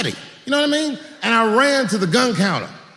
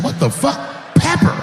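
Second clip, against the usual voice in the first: be floored by the word; shocked disbelief; 9 semitones higher than usual. Retold or imitated. Shocked reaction.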